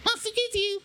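very high squeaky voice; small squeaky answer; squeaky puppet voice. Pretend.

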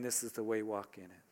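hushed, fading almost to a breath; soft voice behind you. Acted. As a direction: let the words drift away like a distant inner voice. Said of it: "I will be a voice behind you".

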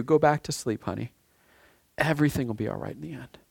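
put-on soft, hushed comfort to a frightened child; tender, quiet reassurance; soothing a child. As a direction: speak it softly, like tucking a child in. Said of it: "God is saying to you".

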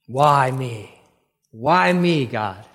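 drawn-out mock moan, dropping away at the end; self-pitying lament. Jacob crashes in on himself before God, mock self-pitying lament. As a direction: imitate a man wallowing in his woe. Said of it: "goes just crashing in on himself".

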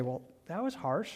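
soft, offhand observation; casual remark. Acted. A churchgoer casually commenting on someone's mood, offhand. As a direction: toss it off casually and quietly. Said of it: "very casually say".

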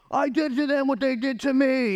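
put-on high, loud whiny toddler voice; hammed-up bratty Samson; childish tantrum. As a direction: sulk and protest like a two-year-old.

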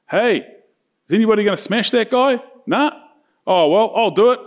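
brisk, breezy imitation of an eager volunteer; cocky volunteer David. David volunteering to fight Goliath, mock cocky.

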